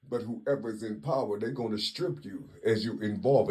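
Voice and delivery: ordinary delivery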